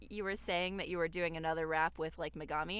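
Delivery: ordinary delivery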